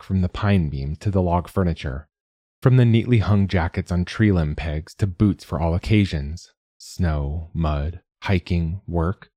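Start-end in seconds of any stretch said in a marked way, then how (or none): none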